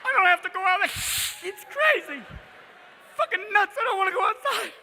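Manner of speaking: high, loud panicky cat voice; hammed-up high-pitched alarm; frantic indoor cat. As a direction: insist in a mock panic.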